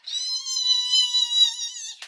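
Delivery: high long excited squeal. Real, not acted. Squealing over getting asked for her number. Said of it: "So I'm excited".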